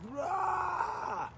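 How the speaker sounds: high, strained dry-heave noise; retching heave. Retold or imitated. Telling fans about hospital vomiting, high retching heave. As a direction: mimic a violent dry heave. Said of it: "but one after another".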